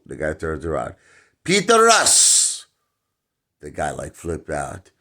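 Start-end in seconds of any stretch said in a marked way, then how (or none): 1.4-2.7 "Peter Russ" called out slowly, high and loud; calling Peter Russ. Retold or imitated. Comedian calling the waiter, "Peter Russ" yelled slow and high. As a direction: call "Peter Russ" across the room, drawn out. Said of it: "Very bad Russian".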